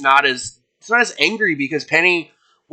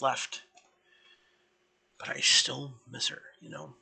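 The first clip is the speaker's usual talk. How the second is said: soft, heavy-hearted confession; a weary leader admitting she misses her friend; grieving team leader; 6 dB quieter than usual. Acted. Antiope confides her grief.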